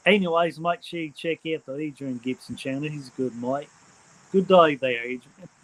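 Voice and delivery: hammed-up amateur Aussie accent; Australian accent. Streamer plugging a mate's channel in imitated Australian accent. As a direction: imitate an Australian, loud on the opening words. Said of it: "imitating the Australian accent".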